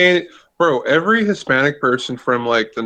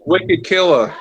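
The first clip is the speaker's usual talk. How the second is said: higher-pitched Boston slang accent; 5 semitones higher than usual. Shown as a demonstration. Friend showing off Boston slang, Boston accent. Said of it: "say it in a Boston accent".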